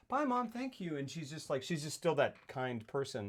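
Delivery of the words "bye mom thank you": kindly daughter's goodbye. Acted. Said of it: "She goes".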